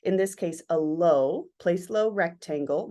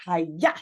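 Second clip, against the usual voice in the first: a quick hi-ya that builds and then hits; a mock karate cry to show the impact; sharp impact cry; 4 semitones lower than usual. Shown as a demonstration. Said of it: "So it's like".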